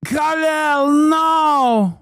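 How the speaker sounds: put-on high shouted parody accent; shouted mock Middle Eastern accent. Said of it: "It takes so many different forms".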